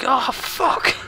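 sudden high yelp of alarm, mock panic; shocked yelp. Gamer hearing the gunship noise, high shocked yelp. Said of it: "you're just like".

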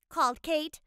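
ordinary delivery

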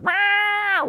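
high squealing meow noise; screeching meow. Retold or imitated. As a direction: squeal it like a howling video card. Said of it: "it was like".

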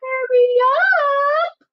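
high, slow piggy call; little pig. Acted. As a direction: sing it out high and stretched. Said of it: "said Little Pig".

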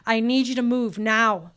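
loud, urgent order to move; urgent command. Retold or imitated. Pastor ordering the dazed teen out of the wrecked car, loud and urgent. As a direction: get them moving right now, firmly.

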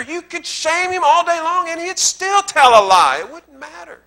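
high, loud, ringing pulpit preaching; preaching at full force. Acted. Pastor warning the congregation against lying, preached high and loud. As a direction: lift it up and preach it hard.

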